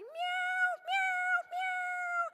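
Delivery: very high, loud mock meows; kitten meowing. Imitating Prince over the litter box, loud high meows. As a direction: squeal like a distressed kitten. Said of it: "and Prince is like".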